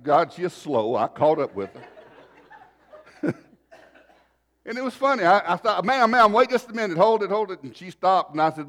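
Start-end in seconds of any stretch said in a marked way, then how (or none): 5.8-7.6 re-enacted urgent call, high and loud; calling after someone. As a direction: flag her down from behind. Calls after woman in parking lot.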